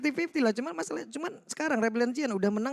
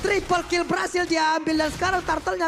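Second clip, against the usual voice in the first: high, loud, driving play-by-play; fighting game announcer; 5 semitones higher, 4 dB louder than usual. Acted. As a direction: call the team fight like it's live. Live team fight play-by-play.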